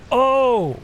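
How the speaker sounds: high, sudden commentator's gasp of "oh"; excited surprise. Real, not acted. Commentator spotting a sneak attack, excited surprise. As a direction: burst out in real surprise, pitch jumping up.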